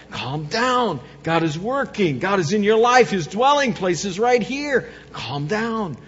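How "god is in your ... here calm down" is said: ordinary talk, nothing marked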